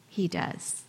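hushed and sure, barely above a breath; soft answer. Real, not acted. Pastor answering the congregation, hushed and sure. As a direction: drop to a hushed, certain reply. Quiet answer to rhetorical question.

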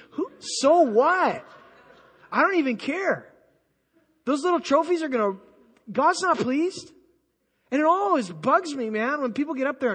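worked-up preaching, pitched high; hyped up. Real, not acted. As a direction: get carried away, indignant and emphatic. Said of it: "I'm getting a little hyped up".

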